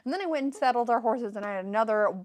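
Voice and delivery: fast, running-on explanation; rattled-off list. Retold or imitated. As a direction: reel it off quickly without stopping. Acted character rattles off her drinks.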